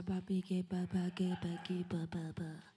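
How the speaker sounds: soft babbled syllables; quiet glossolalia demo; tongues demo. Shown as a demonstration. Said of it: "speak in tongues".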